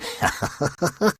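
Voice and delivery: high breathy giggle; impish giggle. Acted. Puck to the audience, giggling. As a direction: giggle mischievously. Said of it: "Puck starts giggling".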